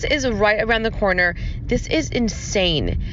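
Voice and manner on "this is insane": usual speaking voice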